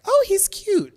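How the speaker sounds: very high, loud, overdone squeal; octave-up fake compliment. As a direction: squeal a polite lie. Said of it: "their voice goes up an octave".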